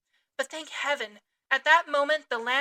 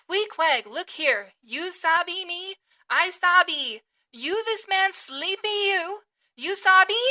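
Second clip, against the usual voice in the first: louder, higher, slow pidgin; loud broken English; 5 semitones higher, 7 dB louder, slower than usual. Acted. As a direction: make the foreigner understand by raising your voice. Talking loudly to Queequeg.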